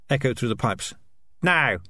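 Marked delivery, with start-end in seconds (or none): 1.4-1.8 short raised shout; narrated shout. A narrator's reading. Dark Tan ordering everyone in the cage room, raised shout. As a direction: bark the word out, pitched up. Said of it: "shouted Dark Tan".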